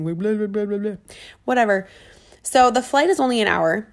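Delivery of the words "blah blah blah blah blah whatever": ordinary delivery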